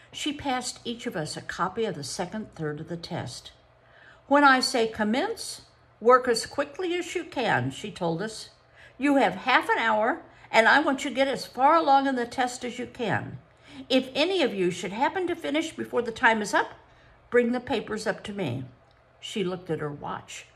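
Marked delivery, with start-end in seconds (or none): 4.3-7.7 brisk teacher voice, lightly raised; lightly voiced strict examiner; stern examiner. A narrator's reading. Narrator voices psychologist. 9.0-18.6 brisk teacher voice, lightly raised; lightly voiced strict examiner; stern examiner. A narrator's reading. Psychologist instructions.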